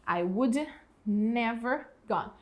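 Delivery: I would never gone said very slowly, word by word; frase modelo bem devagar; slow model sentence. Shown as a demonstration. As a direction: spell it out slowly for students. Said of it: "teacher models corrected sentence".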